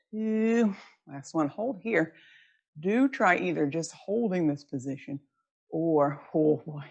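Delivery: ordinary talk, nothing marked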